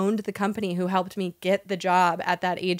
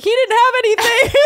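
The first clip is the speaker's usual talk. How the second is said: high, loud frantic quote; high strung mock panic; 7 dB louder than usual. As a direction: blurt it out wired and panicky. Acted quote to dealer.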